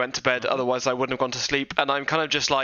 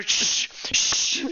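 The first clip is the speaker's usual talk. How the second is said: whispered urgent shush; librarian shushing whisper; 7 semitones higher than usual. Acted. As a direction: hush him in a sharp whisper. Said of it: "I turn around to Sirius and I go".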